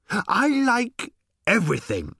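high, slow, swooning moan; moaning robot. A narrator's reading. Little robot moaning happily at Ford. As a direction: moan it in bliss. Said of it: "moaned the robot".

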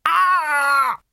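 loud, very high drawn-out "aah"; a strained yelp held on one high note; high pained cry. A narrator's reading. Ford in danger at the bar, high pained cry.

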